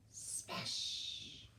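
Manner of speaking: whispered smash; breathy sound-effect crash. Shown as a demonstration. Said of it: "There's a great big loud".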